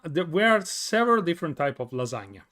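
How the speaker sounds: ordinary talk, nothing marked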